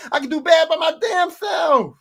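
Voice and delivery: high, loud indignant shout; mad team owner. Pretend. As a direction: shout it as the fed-up boss. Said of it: "I'm so mad".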